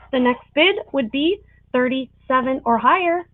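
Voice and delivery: ordinary talk, nothing marked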